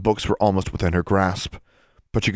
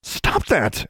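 gasped; 6 semitones higher than usual. A narrator's reading. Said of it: "Alexandra gasped".

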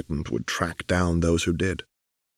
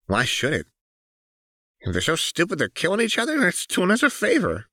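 high, fast, mocking girl's voice; sneering Jinx; 12 semitones higher than usual. Jinx mocking the dead Zaunites, high and sneering. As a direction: sneer it out, pitched well up and quick. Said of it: "with a sharp cackle".

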